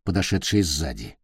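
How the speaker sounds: usual speaking voice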